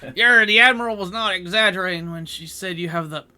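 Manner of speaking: loud pirate slipping into country twang; butchered pirate accent, loud; terrible pirate accent. Acted. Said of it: "I do a terrible pirate accent".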